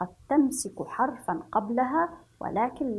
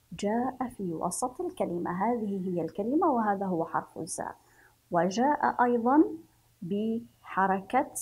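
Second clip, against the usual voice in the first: ordinary delivery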